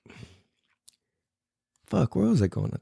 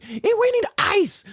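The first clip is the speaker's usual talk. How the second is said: high, slow, stretched-out cry; mock panicked nurse; slower than usual. Nurse demanding ice for a broken arm, panicked. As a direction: imitate a frantic nurse up high.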